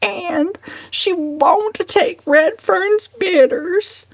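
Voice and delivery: high, slow complaining cousin; fretful old cousin. Acted. Cousin Stickles complaining to the family, whiny. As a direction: whine it out slowly like a fussy spinster.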